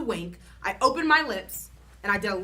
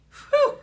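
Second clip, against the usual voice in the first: relieved whew; 8 dB louder than usual. A narrator's reading. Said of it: "I said".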